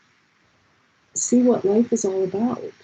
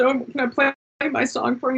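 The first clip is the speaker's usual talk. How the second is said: higher-pitched mock-tearful request; half-crying; 7 semitones higher than usual. On the phone to a friend, mock-tearful plea. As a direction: ask pleadingly, imitating someone close to tears. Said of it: "I'm kinda crying".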